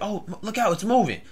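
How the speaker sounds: high-pitched mock alarm; drugged stranger's warning. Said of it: "either he was crazy or he was on".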